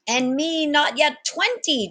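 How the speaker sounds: high, loud, slow protest; indignant young sailor; young pirate chiming in. Acted. Young pirate protesting to the captain, high and slow. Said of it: "chimed in Jimmy Puka Puka".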